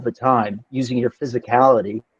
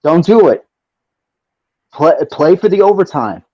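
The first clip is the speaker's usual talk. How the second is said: loud pleading; 4 semitones higher, 6 dB louder than usual. Real, not acted.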